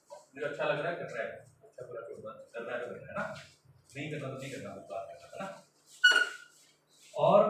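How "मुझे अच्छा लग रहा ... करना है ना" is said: feeling brain's voice. Retold or imitated. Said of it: "emotions, feelings".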